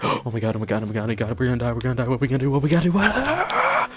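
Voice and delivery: hammed-up thirteen-year-old freaking out; mock panic. Retold panic at cop.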